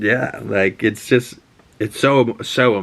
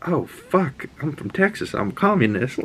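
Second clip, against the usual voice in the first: higher, softer defeated mutter; mock dismay, higher and softer; resigned Tommy; 6 semitones higher, 4 dB quieter than usual. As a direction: say it like giving up. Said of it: "Tommy's like".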